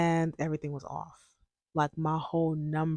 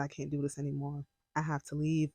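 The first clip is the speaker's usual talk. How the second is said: retold crying; 3 semitones lower, 10 dB quieter, faster than usual. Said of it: "I remember just crying on my bed and said".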